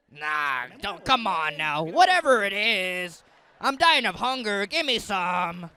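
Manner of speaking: loud, pushy gangster Esau. Acted. Starving Esau pressing his brother for soup, loud pushy gangster voice. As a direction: lean on him like a street tough. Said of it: "He was like a gangster".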